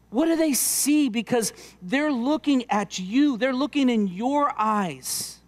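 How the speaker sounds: slow, weighty appeal to the fathers; slow earnest preaching. Acted. Preacher slowly asking dads what their kids see. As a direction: let each question land slowly.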